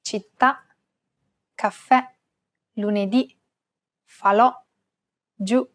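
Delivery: "Città, caffè, lunedì, falò, giù" each stressed on the last vowel; stress on final vowel. Shown as a demonstration. Teacher shows grave accent examples, "Città, caffè, lunedì, falò, giù" stressed on final vowel. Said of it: "telling you to stress this vowel".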